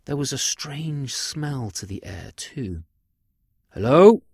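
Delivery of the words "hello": loud, rising call into an empty room; raised voice, pitched up. A narrator's reading. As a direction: cry out a questioning hello. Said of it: "cried out Caractacus".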